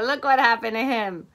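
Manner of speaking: ordinary delivery, nothing marked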